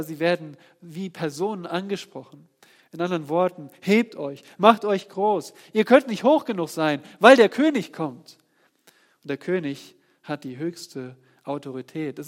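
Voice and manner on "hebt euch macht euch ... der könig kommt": loud, rising sermon build; fiery pulpit climax; full-force preaching. Acted. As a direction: preach it out, higher and louder.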